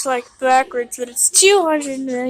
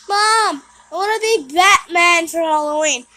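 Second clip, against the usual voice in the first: put-on high, loud kid voice; whiny little kid begging mom; little brother voice; 4 semitones higher, 6 dB louder than usual.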